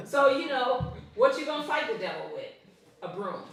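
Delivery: ordinary talk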